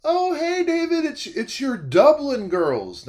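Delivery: put-on high, drawn-out coo from doting old ladies; high-pitched, stretched-out mock ladies' greeting; elderly ladies' greeting. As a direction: sing out a long, delighted hello as gushing ladies. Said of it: "and they're like".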